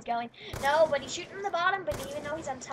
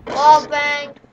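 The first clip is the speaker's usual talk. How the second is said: forceful middle call in a build-up; louder callout; 14 dB louder than usual. Real, not acted. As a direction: punch it out much louder. Second of escalating repeated calls.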